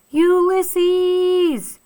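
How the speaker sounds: high, loud call into the dark; shouting a name. A narrator's reading. Tootie calling for Ulysses in the dark, loud high shout. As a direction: call out for the lost squirrel. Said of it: "shouted Tootie".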